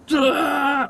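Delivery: high loud strained grunt; straining grunt. Retold or imitated. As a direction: grunt hard as if moving things with your mind. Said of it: "It'll be like".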